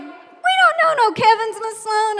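high, loud classroom objection; protesting kids. Retold or imitated. Pupils laughing at the teacher's prediction, loud protest. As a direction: call out in amused disbelief.

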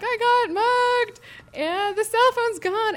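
high, loud, slow tearful wail; wailing through tears. Retold or imitated. Reporter bursting into tears to her colleagues, high slow wail. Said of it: "and I'm like".

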